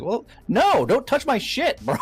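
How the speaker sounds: high, loud warning; retold scolding. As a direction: warn the player off your paint job. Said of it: "I'm like".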